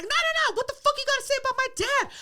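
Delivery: very high, fast irate rant; mock hot-headed daughter on the warpath; imitated outrage. Furious daughter refusing to calm.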